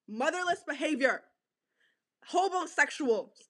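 ordinary delivery

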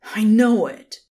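loud angry snarl; snarled again. A narrator's reading. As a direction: bite out each word, furious. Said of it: "the Son of God snarled".

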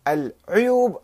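العيوب said al-'uyub; العيوب starting with "'u"; with damma. Shown as a demonstration. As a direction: round the first vowel of العيوب to u. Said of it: "بالضم والكسر".

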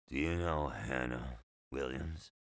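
low, soft, slurred rasp of a patient; raspy, slurred voice. A narrator's reading. Patient greeting the boy in the next bed, raspy and slurred. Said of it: "He spoke with a raspy, slurred voice".

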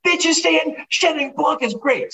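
high, mock-outraged squeal at the seatmate; imitating himself on the plane, high and outraged; indignant outburst. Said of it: "Elbowing the guy next to me, going".

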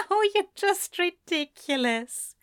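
high, laughing fond protest; laugh, then exclaim in a high character voice; laughing exclamation. A narrator's reading. Angel teased by his lover, laughing exclamation.

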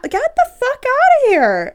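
high, loud, drawn-out dismissal; indignant yell. Pretend. Woman telling off the dick-pic sender, indignant yell.

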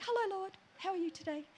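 high bird-like greeting, not pushed; little bird voice. Retold or imitated. Repeating her prayer routine, little bird voice. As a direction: chirp it lightly and high. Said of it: "same voice".

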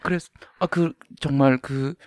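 ordinary talk, nothing marked

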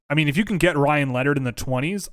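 ordinary talk, nothing marked

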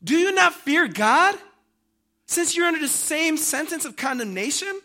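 high, loud reproving question; rebuking. Acted. Criminal correcting the other thief beside Jesus, rebuking. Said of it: "rebuked him".

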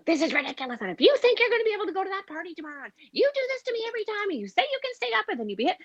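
shrill, high-pitched mock tirade rushed out fast; frantic yelling parent. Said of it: "you start yelling".

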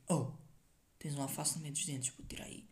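dropping to a hushed whisper; quiet. Retold or imitated. Telling a friend about his teeth, hushed. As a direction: say it under your breath. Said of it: "Dizem tipo".

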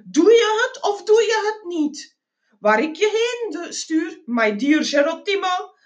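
high, jovial pressuring salesman; pushy travel agent. A narrator's reading. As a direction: press for a decision, pitched high. (opdringerige, joviale verkoper)

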